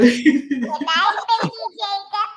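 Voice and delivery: laughing. Real, not acted. Laughing at the machine noises.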